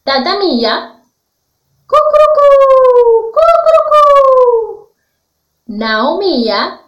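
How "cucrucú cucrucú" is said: high, loud, drawn-out cock-a-doodle-doo; rooster crow. Shown as a demonstration. Teacher showing children the rooster's call, high crowing. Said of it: "Ahora vamos a hacer los sonidos de estos animales".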